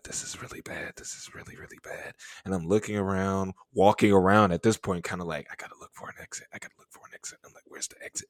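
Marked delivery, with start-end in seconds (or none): 0.0-2.1 hushed whisper of mounting dread; panicked whisper. Retold or imitated. 5.5-8.3 urgent hushed whisper, repeated; frantic whisper. Retold or imitated. Searching for exit in panic.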